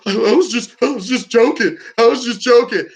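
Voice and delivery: hammed-up frightened backpedal; mock scared pleading. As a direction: whine it out like he's got a knife at his throat.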